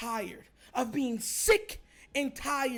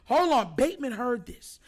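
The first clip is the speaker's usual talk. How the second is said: ordinary delivery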